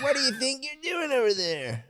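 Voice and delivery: put-on high squeaky baby voice, laughing through it; sassy silly baby. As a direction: play a cheeky newborn with attitude. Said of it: "a silly baby with a lot of attitude".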